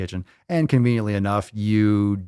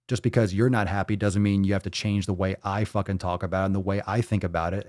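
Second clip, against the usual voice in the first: ordinary talk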